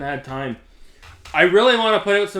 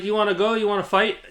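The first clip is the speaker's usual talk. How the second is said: higher, playful mock fight offer; joking challenge; 6 semitones higher, faster than usual. Retelling a prank caller's joking challenge to fight, playful. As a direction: tease him with a fake challenge to fight. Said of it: "Just jokingly".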